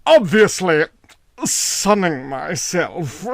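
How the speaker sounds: slow, amused, otherworldly drawl with a laugh; boy lounging in the sun, teasing the landowner; lazy wild boy. A narrator's reading. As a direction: toy with the questioner. Wild boy answers lazily.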